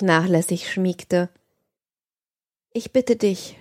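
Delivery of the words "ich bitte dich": ordinary delivery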